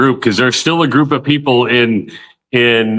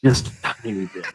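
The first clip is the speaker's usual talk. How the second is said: softer, sheepish confession; quiet, sheepish aside; sheepish admission; 4 semitones lower, 8 dB quieter than usual. Real, not acted. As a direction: own up to it quietly. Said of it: "I'm a little drunk".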